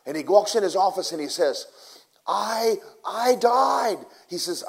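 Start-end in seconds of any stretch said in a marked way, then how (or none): 2.2-4.0 high, loud retold blurt; urgent announcement. Revived man telling Pastor Cho he died, exclaiming. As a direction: exclaim it in mock shock.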